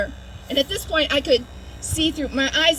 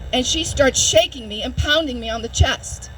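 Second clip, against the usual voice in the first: usual speaking voice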